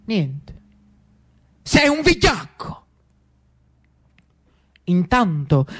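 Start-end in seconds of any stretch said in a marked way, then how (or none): 0.1-2.8 contemptuous insult. Acted. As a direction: spit out coward. Lucio insults his brother.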